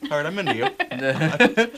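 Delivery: high, loud, slow "all right"; impressed approval. Retold or imitated. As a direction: won over and loudly pleased. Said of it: "who I was like".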